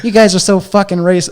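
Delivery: high, loud mock accusation; imitated accusing yell. Comic accusing the crowd of racism, loud mock yell. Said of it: "And he goes".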